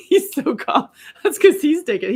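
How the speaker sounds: high, loud laughing words. Real, not acted. Joking to viewers about his calmness, laughing. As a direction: crack up while explaining.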